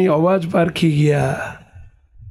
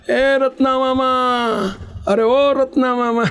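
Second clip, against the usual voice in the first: raised pitch, sing-out call without extra volume; 7 semitones higher than usual. Acted. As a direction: call for an uncle far away, stretching the vowels. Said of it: "એને તો માંડી બૂમો પાડવા".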